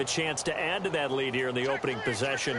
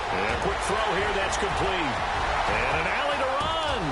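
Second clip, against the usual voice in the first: football announcer riding the big gain; excited play-by-play; 4 semitones higher than usual. Acted. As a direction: build from quick setup to a higher, drawn-out call. Live play-by-play of a completed pass.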